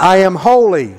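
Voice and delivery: firm, projected declaration; like you mean it. Shown as a demonstration. Preacher leads congregation in declarations against worry, firm and meaning it. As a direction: declare it with conviction for a crowd to repeat. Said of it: "say it like you mean it".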